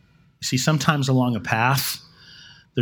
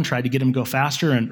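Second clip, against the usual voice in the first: ordinary talk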